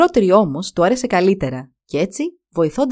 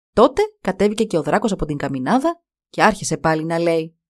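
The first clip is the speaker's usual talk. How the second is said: usual speaking voice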